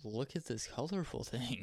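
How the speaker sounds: slow, soft mimicked parent voice; mock parent to child. Friend mimics a mother showing her kid a colorful thing, slow and soft. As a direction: draw it out softly, imitating a parent.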